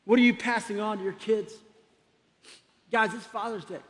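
normal talking voice

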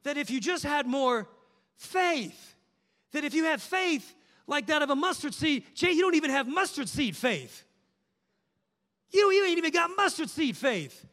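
high, mocking voice of a scolding churchgoer; mock scolding believer. Preacher mimicking churchgoers who shame weak faith, mocking.

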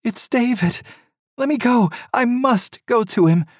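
high, loud urgent plea; mother begs to go to her son, frantic. A narrator's reading. Mother pleads to reach son.

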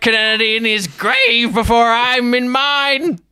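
high, loud, fast, half-laughing threats; unhinged goblin laying out his terms, high and loud; manic ultimatum. Acted. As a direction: bark demands with a knife at the king's throat. Norbert issues his hostage demands.

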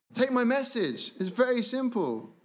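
preacher peaking on the command; rising emphatic preaching. Real, not acted. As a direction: lift it high like a rallying cry. Preacher's climax on God's command.

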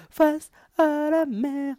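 high, slow, swooning sing-song; carried away. Real, not acted. Guest swooning over the sea view, carried away. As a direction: drift off dreamily, then catch yourself. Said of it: "Pardon, je, je me calme".

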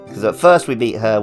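ordinary delivery

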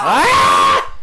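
loud low startled shout; real startled yell. Real, not acted. Sudden jump scare.

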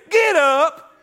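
loud, high get up; in-your-face. Pretend. Friend in your face, loud and high. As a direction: bark it at a moping friend.

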